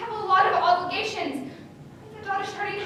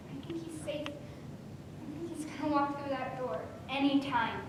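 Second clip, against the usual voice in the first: softer and slow, tender and hopeful; soft reassurance; 3 semitones lower, 11 dB quieter than usual. Acted. Daughter gently reassures her mother about her father, tender.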